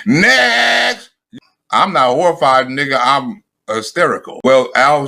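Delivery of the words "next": dismissive shout. Real, not acted. Dismissing the speaker.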